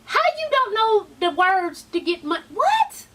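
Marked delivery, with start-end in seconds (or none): 2.5-3.1 much higher, sharp disbelieving yelp; shocked at the flub; real squeaky disbelief; incredulous what. Real, not acted. Baffled by the performance.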